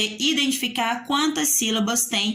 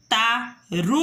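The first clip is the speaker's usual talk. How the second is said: "tartaruga" split TAR... TA... RU... GA; four detached syllables, tar / ta / ru / ga. Shown as a demonstration. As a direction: say "tartaruga" as four separate beats with pauses. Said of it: "Quantos sons saiu da minha boquinha".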